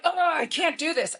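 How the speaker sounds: frustrated. Retold or imitated. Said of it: "hear her frustrated with her homework".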